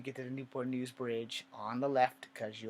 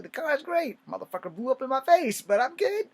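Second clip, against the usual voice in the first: forced cheer; 12 semitones higher, 9 dB louder than usual. Retold or imitated. Brushing off his burned face.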